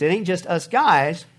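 ordinary talk

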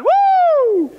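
high loud woo of delight; joyful whoop. Real, not acted. Preacher whooping after a deathbed quote, loud joy. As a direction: let out a shout of joy.

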